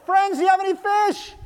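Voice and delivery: high, loud hail; yelled call. Acted. Jesus hails the fishermen from shore, yelling. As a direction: call out as if across the water. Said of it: "Jesus yells".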